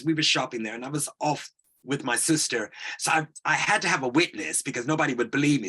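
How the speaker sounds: normal talking voice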